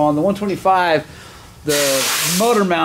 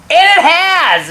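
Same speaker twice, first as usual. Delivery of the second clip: high, loud triumphant punchline; gleeful triumph; 8 dB louder, slower than usual. Real, not acted. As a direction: crow it out loudly and high. Gleeful punchline of the nickname story.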